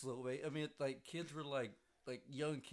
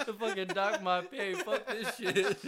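higher, louder, slow and laughing; laughing mock quote; 7 semitones higher, 8 dB louder, slower than usual. Imitating a worker quitting, laughing to friends. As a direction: grumble it while laughing. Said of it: "He's like".

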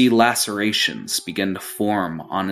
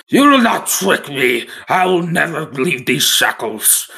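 higher and louder, rising to a shriek; panicked yelling; 9 semitones higher, 5 dB louder than usual. Acted. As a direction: scream it like he's cornered. Panicked prisoner refuses the deal.

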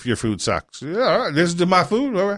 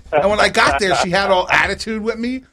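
ordinary delivery

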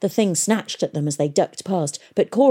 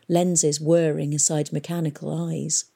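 ordinary delivery, nothing marked

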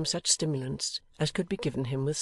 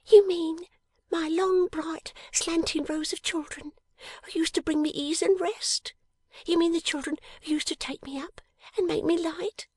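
high, hushed and dreamy; high breathy girl's whisper; tender whispering girl; 3 dB louder than usual. Acted. Jenny's voice, hushed.

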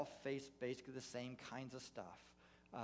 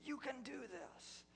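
retold cheering shout. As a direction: call down the mountain to spur runners on. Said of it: "they're screaming".

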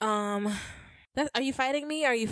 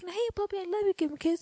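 cooing to puppy; 8 semitones higher, faster than usual. Retold or imitated. Owner greeting the beloved dog, cooing. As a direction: coo at the puppy in a high little voice.

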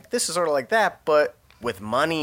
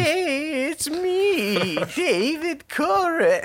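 high, slow airy cult leader voice; cartoony high-pitched Koresh bit; David Koresh impression; much slower than usual. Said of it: "sort of an impression of him".

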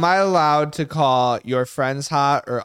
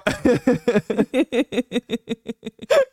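high rolling giggle; real uncontrolled laughter; long laughing fit; 8 semitones higher than usual. Real, not acted. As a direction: laugh on and on, helplessly.

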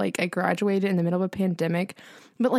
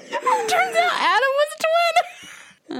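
laughing; much slower than usual. Real, not acted. Reveals twin cousin, laughing.

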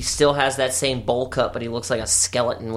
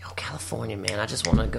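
whisper; 3 semitones lower, 8 dB quieter, faster than usual. Real, not acted. Wistful aside about California.

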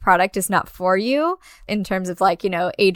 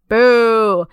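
loud, drawn-out "boo"; mock booing; 5 dB louder than usual. Co-host jeers the reindeer punch mid-summary, mock booing. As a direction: jeer the hero playfully.

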